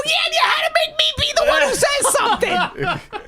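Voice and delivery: very high, loud complaint; outraged shouting. Retold or imitated. Passenger blaming the couple in front, outraged shouting. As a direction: scream the accusation at them.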